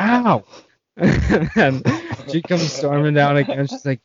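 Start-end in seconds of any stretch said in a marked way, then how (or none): 0.0-0.4 high-pitched acted "ow"; imitated cry of pain; mock pained yelp. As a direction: yelp as if hit by a pillow. Acted cry at pillow hit.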